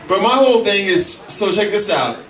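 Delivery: ordinary talk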